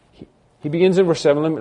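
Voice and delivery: ordinary talk, nothing marked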